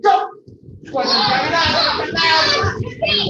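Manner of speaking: loud, high drill commands; coach yelling. Real, not acted. Coach driving squat jumps.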